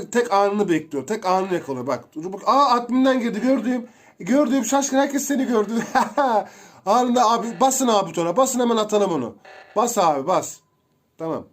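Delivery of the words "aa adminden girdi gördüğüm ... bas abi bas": high, loud and rushed, urging everyone on; excited shout. Real, not acted. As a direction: yell at the team to hit the button now. Catches the killer in the act. (heyecanla, yüksek sesle bağırarak)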